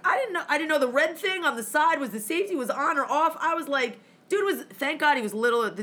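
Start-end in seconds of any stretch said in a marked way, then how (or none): none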